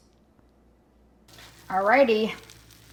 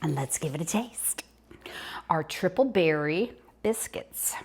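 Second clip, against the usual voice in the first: whispering. Real, not acted. Presenting the finished biscuits.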